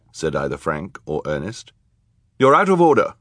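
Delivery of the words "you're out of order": high, loud stern rebuke. A narrator's reading. Judge banging her gavel at interrupters, stern. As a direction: call the court to order sternly. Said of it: "Justice Strauss said sternly".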